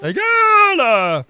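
very slow, high stretched shout; drawn-out yell. Acted. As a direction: wail it out at length. Echoes the yelled insult. (cri aigu très traînant)

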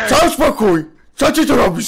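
loud, high, outraged shouting; gniewny krzyk. Acted. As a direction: throw it back in a furious yell. Kłótnia ze zdrajcami. (oburzony, wysoki krzyk)

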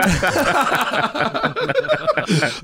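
high burst of real laughter. Real, not acted. Interviewee laughing off a teasing question about the drummer. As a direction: laugh it off, caught out.

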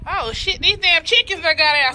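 alarmed cry about loose chickens; suddenly loud and high, caught off guard; startled. Real, not acted. Vlogger spots escaped chickens, startled and loud.

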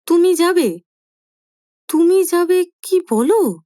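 high, loud disbelief; flabbergasted. A narrator's reading. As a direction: son thunderstruck, voice shooting up. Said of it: "আকাশ থেকে পড়বার".

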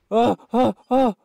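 quick string of yelps; three short bursts of screaming; short screams. Real, not acted. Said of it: "I like screaming!".

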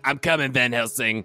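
high, eager character call; high determined character voice; Ginger calling. Acted. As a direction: shout ahead that help is coming. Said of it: "she's like".

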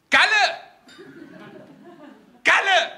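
high, called-out name; calling across the restaurant. Retold or imitated. Waiter calling his colleague across the restaurant, high called-out name. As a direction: call a colleague from across the room.

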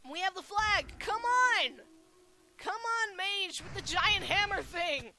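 high, excited shouting to a teammate; urgent cheering on. Real, not acted. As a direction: urge your teammate on at the crucial moment. Urging teammate on.

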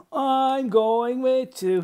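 high, loud, drawn-out announcement; excited child. Acted. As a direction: declare it like a thrilled little boy. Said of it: "Hal was excited".

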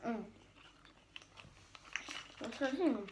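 words around chewing; chewing gum. Real, not acted. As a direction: mouth working on gum. Seen 0: Girl puts gum in mouth just before, chewing while speaking.

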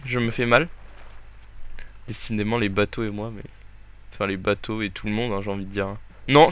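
ordinary talk, nothing marked

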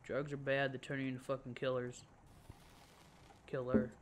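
ordinary talk, nothing marked

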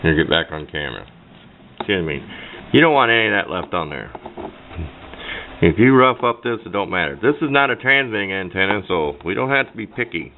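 ordinary talk

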